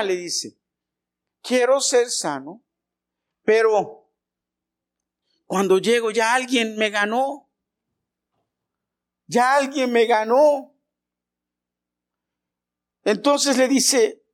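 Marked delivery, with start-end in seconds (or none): 1.4-10.7 higher, pleading voice of a sick man; sick man begging Jesus, higher and pleading. Acted. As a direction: beg the Lord from a lifetime of waiting. Sick man answers the Lord.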